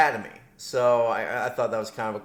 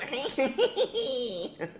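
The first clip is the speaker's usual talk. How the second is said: very high, drawn-out goblin giggle; goblin cackle; 13 semitones higher than usual. Pretend. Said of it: "Goblin voice".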